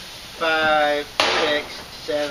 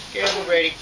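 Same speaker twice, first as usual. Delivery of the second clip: usual speaking voice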